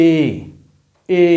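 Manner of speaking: এ said as a plain "e", not "æ"; vowel demo. Shown as a demonstration. Teacher to students, contrasting vowels: এ said as a clear "e". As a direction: model the vowel এ cleanly. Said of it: "তখন জফলাটার উচ্চারণ হবে".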